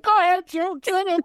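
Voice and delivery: very high, squealed cheer; gleeful egging-on. Retold or imitated. Host retelling how they cheered Joe on, high gleeful squeal.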